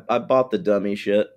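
normal talking voice

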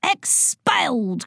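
relishing the word in triumph; triumphant cry. A narrator's reading. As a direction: crow the verdict slowly. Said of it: "cried Boyle triumphantly".

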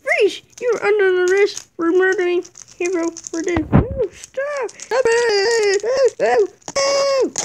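high, loud, frantic commands; loud arrest shouts. Acted. Toy cop arresting Thomas for murder, high and loud. Police arrest and the culprit's protest.

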